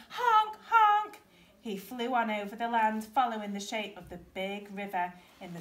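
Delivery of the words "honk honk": two high, loud honks; excited cry. A narrator's reading. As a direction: jubilant bird honking in flight. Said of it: "he cried in excitement".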